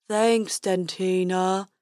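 slow, dopey unicorn voice. A narrator's reading. Fred the unicorn thanking the dentist, slow dopey voice. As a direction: drawl it like a silly unicorn.

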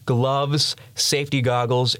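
ordinary talk, nothing marked

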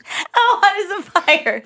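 laughing. Retold or imitated.

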